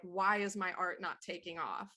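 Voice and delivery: ordinary talk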